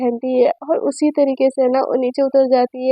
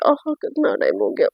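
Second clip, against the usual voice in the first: sleepy waking; 6 dB quieter than usual. Acted. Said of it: "आराम से नींद टूटती है".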